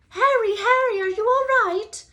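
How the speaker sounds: high, loud worried call. A narrator's reading. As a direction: shout to him across the path. Hermione calling out running.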